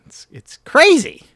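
high, loud, hammed-up crazy; exaggerated excitement. Says it, then repeats it bigger.

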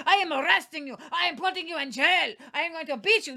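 mock mean Russian policeman threatening an arrested pastor; mean Russian cop. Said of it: "Just be like a mean Russian".

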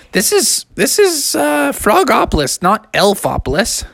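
squeaky disbelieving mother; a high bewildered cartoon mom; mom voice, puzzled.

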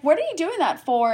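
high-pitched, incredulous retold question; alarmed question. Patient to nurse marking her stomach, alarmed. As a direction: demand an answer, baffled and laughing a little.

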